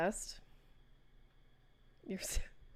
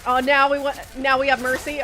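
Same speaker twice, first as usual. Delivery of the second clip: loud incredulous outburst; indignant surprise; 6 semitones higher, 12 dB louder than usual. Real, not acted. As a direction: shout it in disbelief. Monster spares player.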